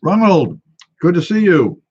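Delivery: loud, high-pitched hail; calling out greeting. Real, not acted. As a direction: call out a hearty hello, pitched high and loud.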